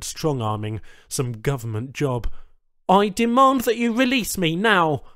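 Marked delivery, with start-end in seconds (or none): 2.9-5.0 loud, high demand. Acted. Strapped-down Charl demands the aliens free him, loud. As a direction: insist on release.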